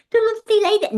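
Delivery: put-on high, loud Liverpool accent. Pupil repeating after the teacher, terrible high Liverpool accent. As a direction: squawk it back in broad Scouse. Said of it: "I had a terrible Liverpool accent".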